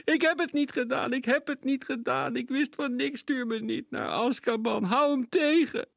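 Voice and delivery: high, loud, terrified pleading; screaming boy. A narrator's reading. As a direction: beg not to be sent away. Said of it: "De jongen begon te gillen". (gillend en smekend)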